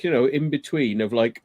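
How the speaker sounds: normal talking voice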